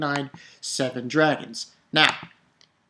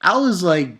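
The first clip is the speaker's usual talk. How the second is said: ordinary talk, nothing marked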